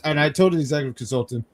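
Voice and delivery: ordinary talk, nothing marked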